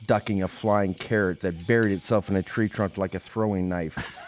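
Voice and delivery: plain reading voice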